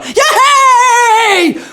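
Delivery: very high, loud cheer; cheering nurses. Retold or imitated. Nurses cheer at the birth, very high loud cheer. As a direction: cheer like a room of happy nurses. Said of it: "nurses going".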